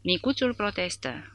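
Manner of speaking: plain reading voice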